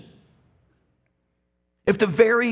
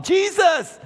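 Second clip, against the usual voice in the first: desperate shout; 9 semitones higher than usual. Acted. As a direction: beg at the top of your lungs. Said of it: "he began to shout".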